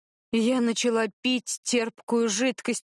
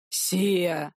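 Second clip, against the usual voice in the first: threatening; 3 semitones lower than usual. A narrator's reading. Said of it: "От угрозы в голосе Вита".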